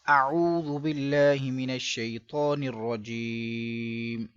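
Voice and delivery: slow, drawn-out chanted recitation; slow Quranic recitation. Shown as a demonstration. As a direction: intone it slowly and reverently. Opening recitation before lesson.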